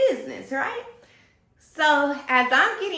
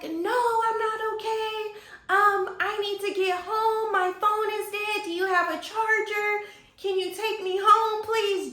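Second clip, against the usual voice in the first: high, whiny imitation of a tearful girl; mock boo-hoo crying; 9 semitones higher than usual. Stranded girl begging a stranger for a ride, mock tearful. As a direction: plead in a pitched-up, sing-song mock sob. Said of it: "She was boo-hoo crying".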